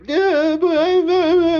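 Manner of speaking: high, loud stammering whine imitating her; mock whining protest. Imitating her as she gets thrown out, mock whining protest. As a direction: babble a hammed-up upset objection up high.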